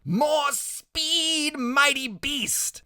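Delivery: high, stretched-out cry without much volume; boy crying out mid-chase; high-pitched shout. A narrator's reading. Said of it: "Freak is shouting".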